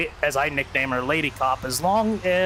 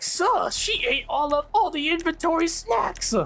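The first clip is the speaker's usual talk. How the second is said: very high, drawn-out put-on wail; high-pitched mock outrage to a boss; Winslow's dismayed complaint. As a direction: complain in a squeaky character voice. Voicing Winslow, upset, in a story.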